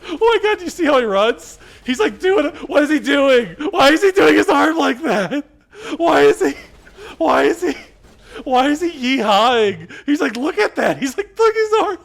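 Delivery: loud, high squeal on the verge of laughter; real helpless hysterics at something absurd; hysterical disbelief. Real, not acted. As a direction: shriek it out, cracking up. Hysterics at character's odd running.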